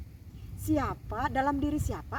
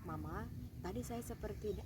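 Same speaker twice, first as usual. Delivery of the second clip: put-on quiet, lower child voice admitting to mama; hushed little confession; child confessing; 4 semitones lower, 8 dB quieter than usual. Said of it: "anak suster perlu cerita". (anak yang lirih mengaku kepada mama)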